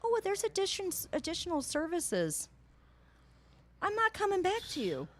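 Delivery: mimicked clerk. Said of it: "She's like".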